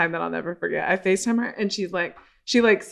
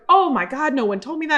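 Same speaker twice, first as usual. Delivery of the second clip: mock gasp of disbelief, pitched up; imitating the host's shocked dismay; shocked realization; 7 semitones higher than usual. Retelling the host learning her podcast was set up wrong, shocked. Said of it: "and she's like".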